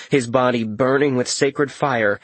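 ordinary delivery

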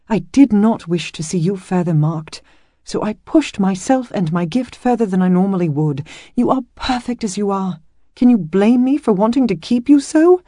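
loud and earnest, pitch rising; belted out. Acted. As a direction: push it out, no longer hushed. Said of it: "belted out in as close to a normal voice".

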